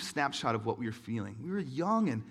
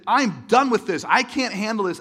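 loud, much higher, fed up; overwhelmed outburst; 7 semitones higher, 12 dB louder than usual. Retold or imitated. Slamming the laptop shut, loud overwhelmed outburst. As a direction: slam the door on the feeling. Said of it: "I said".

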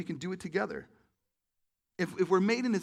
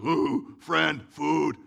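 loud, halting monster grunts; imitating Frankenstein's monster, one word at a time; 9 dB louder, much slower than usual. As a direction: groan out each word like a monster. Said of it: "Monster's like".

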